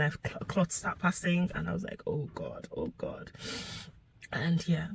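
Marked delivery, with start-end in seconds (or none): 2.1-3.3 low, quiet recalled "oh God"; retold dismay, dropping low and soft; hushed dismay. Woman recalling clots passing, hushed dismay. Recalling her panic as bleeding worsened.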